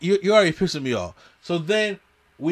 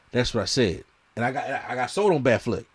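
usual speaking voice

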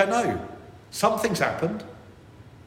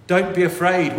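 commanding angel; 5 semitones higher, 7 dB louder than usual. Acted. Angel's words quoted.

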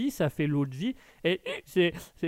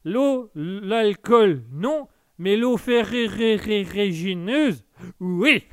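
very slow, louder drawn-out Bourvil sing-song; Bourvil impression; 4 semitones higher, 7 dB louder, much slower than usual. Streamer acts out Bourvil's sketch for the chat, very slow and loud. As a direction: stretch every word like Bourvil's old sketch. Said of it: "Petit sketch de Bourvil".

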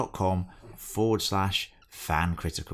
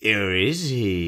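mock suspense; 4 dB louder, slower than usual. Teasing a fake cliffhanger.

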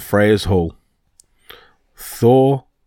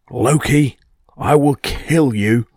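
mock-furious storybook cry, pitched up and drawn out; understated roar of threat, slower and higher; 4 semitones higher, slower than usual. A narrator's reading. Angry Thor calling out to Loki over his missing hammer, furious cry. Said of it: "let out a blood-curdling cry".

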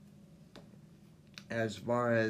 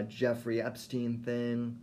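ordinary delivery

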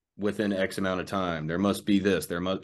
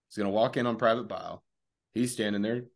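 ordinary talk, nothing marked

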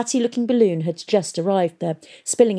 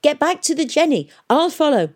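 raised, urgent high-pitched call; shouted; 9 semitones higher, 4 dB louder than usual. A narrator's reading. Fighter sends companions back to the ship, shouting. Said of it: "she shouted at Hester and Tom".

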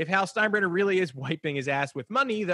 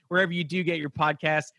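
ordinary talk

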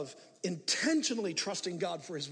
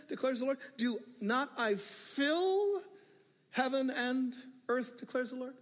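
raised, declaiming Bible voice, slowing on heaven and earth; scripture reading; 8 semitones higher, 3 dB louder than usual. Acted. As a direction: proclaim the verse from the pulpit. Reads Jeremiah aloud.

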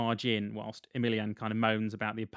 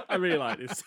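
ordinary delivery

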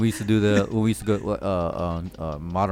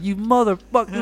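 high, mocking outraged curse; mock angry curse; 12 semitones higher than usual. Betrayed teammate cursing them as the game ends, mock rage. Player stabbing them before nuke.

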